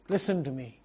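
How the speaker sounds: a hushed, earnest aside; intimate appeal. Real, not acted. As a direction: lean in and speak low. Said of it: "appeal to listeners".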